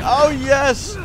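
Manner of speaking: high, loud, delighted cry; thrilled fan. Acted. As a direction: gush like a fan getting a dream request. Acts out fan's thrilled reaction.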